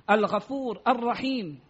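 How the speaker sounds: normal talking voice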